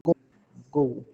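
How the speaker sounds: "go" said just like Portuguese "gol". Shown as a demonstration. Teacher models "go" for students, pronounced like "gol". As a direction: say "go" as in scoring a "gol" in football. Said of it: "Como se tivesse feito um gol mesmo no futebol".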